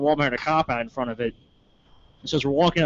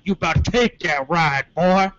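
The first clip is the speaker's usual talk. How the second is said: slow warning, last word loud; imitated cop; 3 semitones higher, 4 dB louder, slower than usual. Cop warning the mouthy friend, slow imitation. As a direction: lay down a drawn-out threat. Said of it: "looks T J and is like".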